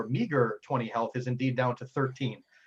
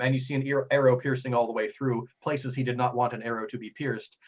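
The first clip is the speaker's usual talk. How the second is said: usual speaking voice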